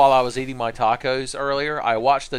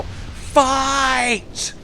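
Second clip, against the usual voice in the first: high, drawn-out cheering yell; 12 semitones higher, 3 dB louder than usual. Pretend. Drunk spectator cheering a fight from a balcony, high yell. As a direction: shout it down from a balcony. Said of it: "lean over the balcony with my beer in my hand and yell".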